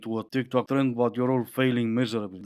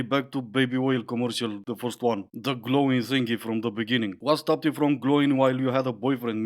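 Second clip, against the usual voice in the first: ordinary delivery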